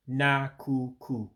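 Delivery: nakuku said slowly, each syllable even, falling at the end; slow careful Igbo nakuku; Igbo word, slow. Shown as a demonstration. Teacher saying nakuku slowly for learners.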